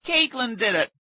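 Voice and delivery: high-pitched accusing cry; distressed shout. A narrator's reading. Boy blames his sister for the glue, upset shout. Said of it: "Aaron shouted".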